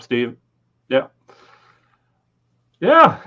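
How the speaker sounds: ordinary talk, nothing marked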